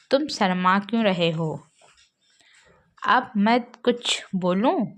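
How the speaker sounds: normal talking voice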